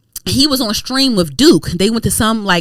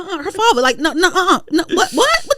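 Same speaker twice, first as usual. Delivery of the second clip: scolding uncle; 5 semitones higher, faster than usual. Retold or imitated. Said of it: "I do like, he like".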